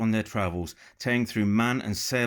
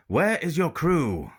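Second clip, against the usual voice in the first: slow, louder, higher pirate call across ships; pirate captain calling across to the women; French pirate crying out; 7 semitones higher, 5 dB louder, slower than usual. Acted. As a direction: call out slowly to the other ship. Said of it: "he cried out".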